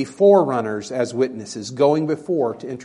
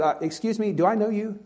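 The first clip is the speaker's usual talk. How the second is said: cynical, higher-pitched brush-off; Nathanael; 6 semitones higher than usual. Acted. Nathanael talking back to the creator of the universe, cynical. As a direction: question a stranger with suspicion, voice raised in pitch. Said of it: "can you hear that in his voice".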